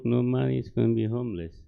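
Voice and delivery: ordinary talk, nothing marked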